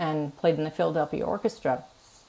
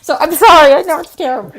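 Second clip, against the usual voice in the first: higher and louder, still giggling; laughing apology; 9 semitones higher, 12 dB louder, faster than usual. Real, not acted. Laughing apology after the punchline.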